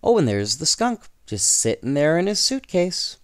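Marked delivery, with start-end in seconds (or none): none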